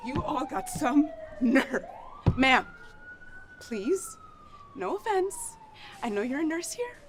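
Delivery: high-pitched, affronted protest; indignant. Acted. Character confronts a hospital nurse, indignant.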